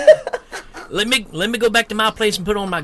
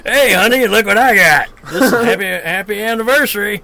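mock proud husband; 3 semitones higher than usual. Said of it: "the dude in Demons".

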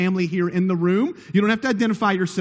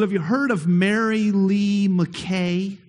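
usual speaking voice

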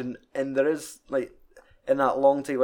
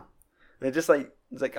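usual speaking voice

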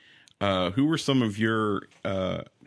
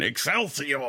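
Stan Lee voice; 5 semitones higher, slower than usual. Retold or imitated. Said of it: "I could just hear you going".

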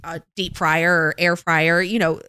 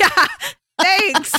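higher, laughing dry thanks; amused mock thanks; 12 semitones higher, 4 dB louder than usual. Mom answering her toddler through laughter, higher. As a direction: laugh it out, half sarcastic. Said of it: "And I'm like".

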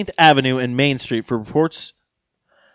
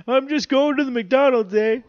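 put-on Canadian accent; 11 semitones higher than usual. Mock teen joyrider voice.

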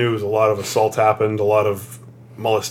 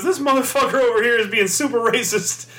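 high put-on old woman complaining; mock indignant old woman; old lady voice; 11 semitones higher than usual. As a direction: voice the old lady calling him out.

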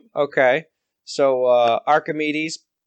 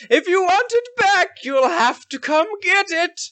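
much higher, taunting villain; Zabuza villain voice. Acted. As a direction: dare him to chase you. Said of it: "and he says".

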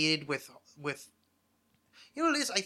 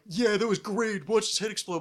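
mock gleeful relish; 6 semitones higher, 5 dB louder than usual. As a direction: ham up a ghoulish fan. Mocking a gleeful viewer of gore.

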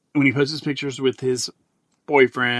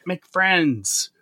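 higher, coaxing plea; pleading; 6 semitones higher, slower than usual. Retold or imitated. Host coaxing his friend to befriend him, higher. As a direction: beg him playfully. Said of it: "I'm like".

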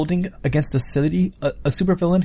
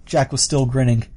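plain reading voice

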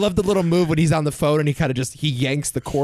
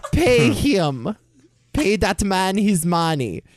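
put-on slow, higher-pitched Russian accent; bad Russian impression; 4 semitones higher, much slower than usual. Co-host showing how the actor sounded, slow hammy Russian accent. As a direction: lay the Russian accent on thick, slowly. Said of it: "You think this is a bad impression?".

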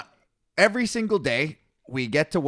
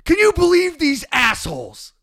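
high, loud, strained impression of a griping coworker; mock whiny coworker; 14 semitones higher, 8 dB louder than usual. Retelling his griping coworker, a loud high mock whine. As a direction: ham up the complaining coworker. Coworker mocked in a retold story.

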